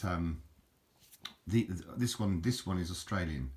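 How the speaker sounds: ordinary talk, nothing marked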